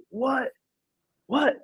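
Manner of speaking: high rising What? What?; shocked disbelief. Retold or imitated. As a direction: retell his own stunned reaction, going up. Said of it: "I'm like".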